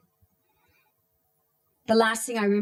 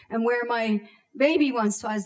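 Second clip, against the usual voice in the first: usual speaking voice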